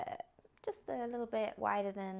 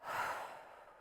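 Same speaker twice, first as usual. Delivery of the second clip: audible sigh out through the mouth; 5 dB quieter than usual. Shown as a demonstration. Yoga teacher showing the class how to sigh the breath out, audible sigh. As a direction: let a sigh go for the class to copy. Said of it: "sigh that breath out".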